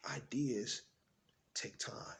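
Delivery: very soft, with a pause mid-phrase; hushed lesson. Acted. Parent sealing the first lesson, hushed. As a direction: let it sink in almost at a whisper.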